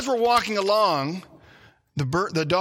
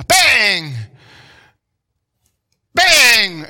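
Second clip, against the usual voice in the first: loud high bang bang; mimicking a shotgun firing; imitated gunshots; 4 semitones higher, 12 dB louder than usual. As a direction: blurt out the gunshot noise. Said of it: "imitated gunshots".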